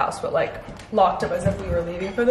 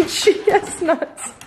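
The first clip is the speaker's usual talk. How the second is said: laughing; 7 semitones higher, much slower than usual. Real, not acted. Laughing at wild dog.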